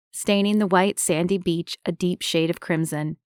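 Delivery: usual speaking voice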